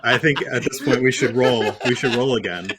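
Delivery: talking through laughter; laughing. Real, not acted. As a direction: say it while still laughing. Still laughing after joke.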